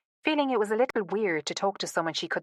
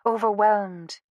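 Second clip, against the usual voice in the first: ordinary delivery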